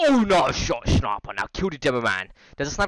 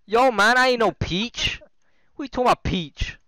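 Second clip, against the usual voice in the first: playing Mario; 8 semitones higher than usual. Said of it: "I'm Mario".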